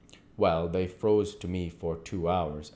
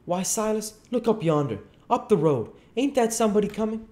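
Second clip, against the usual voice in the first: high, sly calling-out aunt voice, quicker at the end; Aunt Sally; 4 dB louder than usual. Acted. Aunt Sally tricks Silas into looking out the window, high and quick. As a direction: point down the road, pitched high.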